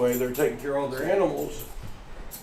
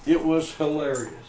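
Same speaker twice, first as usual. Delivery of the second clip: ordinary delivery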